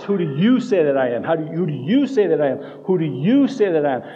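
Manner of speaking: insistent repeated question, "you" punched each time; emphasizing YOU. Acted. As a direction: drive the word "you" straight at the listener. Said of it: "making direct eye contact and emphasizing".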